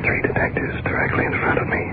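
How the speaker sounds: hushed, voiceless field report; whispered live commentary while creeping up; real whisper. Real, not acted. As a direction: whisper it so as not to attract any attention. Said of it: "We can't speak over just a whisper".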